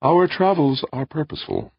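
high, projected, stately voice; calm tenor machine. A narrator's reading. Ancient machine explains its travels to visitor, stately tenor. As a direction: declare the purpose in a high tenor. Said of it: "said a calm, sweet tenor voice".

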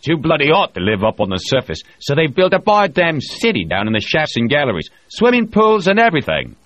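a loud boastful spacer holding court; O'Hara voiced. A narrator's reading. O'Hara brags to the crew, loud and bluff.

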